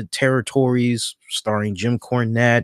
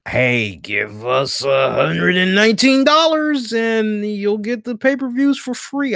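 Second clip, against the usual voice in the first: mocking sales pitch; 8 semitones higher than usual. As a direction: parody the company's cheesy offer. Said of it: "that whole".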